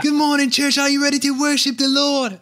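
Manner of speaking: high, hammed-up Australian worship leader greeting; mock Australian accent. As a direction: boom it out like a hyped Australian pastor. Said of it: "when you say it with an Australian accent".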